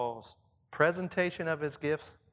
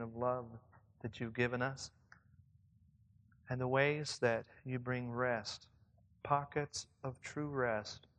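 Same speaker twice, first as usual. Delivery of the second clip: soft, low, measured prayer; 4 semitones lower, 5 dB quieter than usual. Real, not acted. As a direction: pray quietly with gentle pauses. Prays to open the sermon.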